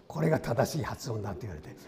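ordinary talk

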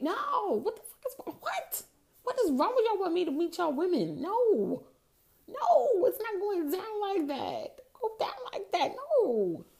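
high-pitched, incredulous outburst; mock-outraged storytelling, voice leaping way up; outraged refusal. Retelling being asked to meet his girlfriend, outraged refusal.

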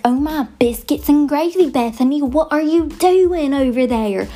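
hammed-up cross country Southern accent; angry Southern. Mock-scolding Bethany about the chickens, angry Southern accent. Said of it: "I get very Southern when I'm mad".

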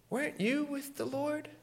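put-on high, soft little girl's question. Little girl asking Peter a question, high and soft. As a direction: ask gently in a small girl's voice.

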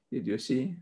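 ordinary delivery

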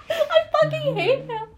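high, giggling through the words; laughing. Real, not acted. Telling friends his embarrassing slip, laughing.

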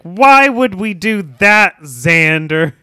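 hammed-up disdainful Cordelia; mock Cordelia scorn. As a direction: drag it out as a haughty brush-off. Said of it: "she's like".

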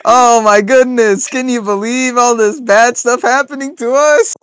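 high, loud, slow melodramatic lament; hammed-up lament. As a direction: overact the pitiful prisoner. Pretend prisoner scene.

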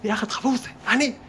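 ordinary delivery

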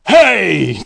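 loud villain bark; angry roar. Acted. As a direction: roar it at the crowd. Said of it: "Doctor Dirt roared".

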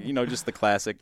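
ordinary delivery

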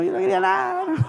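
sudden high thin voice, nothing wanted; high mock whine. Preacher recalling his illness, mock whine high-pitched. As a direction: whine it up high like a sulking patient.

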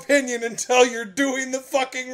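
a hammed-up rant at a friend; mock scolding. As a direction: tell him off for judging early.

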